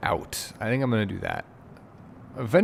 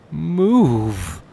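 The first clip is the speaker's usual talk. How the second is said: louder, higher order to move; frustrated command; 5 semitones higher, 7 dB louder than usual. Real, not acted. Gamer yells at passengers blocking the line, frustrated louder command. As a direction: shove them along with one word.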